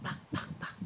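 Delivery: breathy whispered buck buck; whispered clucking. Shown as a demonstration. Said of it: "shows chicken sound to toddler".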